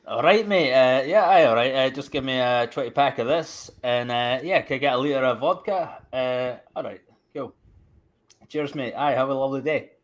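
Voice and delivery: Scottish shop talk with "mate" and "aye"; Scottish dialect. Shown as a demonstration. Ordering booze and smokes from the shopkeeper, in Scottish dialect. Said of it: "Say it in your normal dialect".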